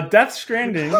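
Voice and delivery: laughing announcement. Real, not acted. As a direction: announce it while cracking up. Announces a lopsided, hilarious matchup.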